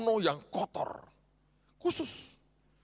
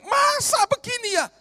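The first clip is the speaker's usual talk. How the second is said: much higher, louder shouted question; provoked shout; provoked outcry; 8 dB louder than usual. Retold or imitated. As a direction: cry out in disbelief. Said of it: "Sampai kita bilang gini".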